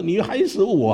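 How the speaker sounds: high exclamation of blame; angry pastor. Retold or imitated. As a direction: pastor scolds the boy. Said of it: "就当得好生气啊". (生气地高声责怪)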